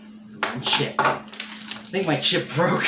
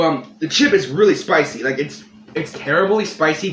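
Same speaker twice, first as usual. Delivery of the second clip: ordinary talk, nothing marked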